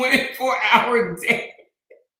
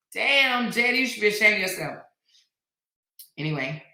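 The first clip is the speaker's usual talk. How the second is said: normal talking voice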